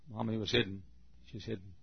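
usual speaking voice

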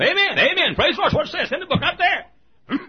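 high, loud amen-shouting churchgoer; imitated shouts of praise, pitched high. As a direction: holler amens like an excited man in the pew. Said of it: "old J V is out there and going".

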